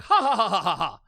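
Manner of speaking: ordinary talk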